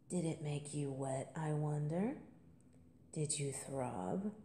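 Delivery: low intimate murmur; hushed tease. Acted. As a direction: tease her gently and quietly. Teasing intimate questions.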